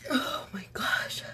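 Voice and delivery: slow whispered gasp as a sneeze builds; whisper before a sneeze. Real, not acted. To viewers while an allergy sneeze builds, whispered. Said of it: "I have another one coming in".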